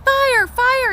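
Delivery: very high, loud fake alarm; alarmed cry. Jack trying to scare the birds off, high loud alarm. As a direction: shout it like a fake emergency. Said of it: "he cried".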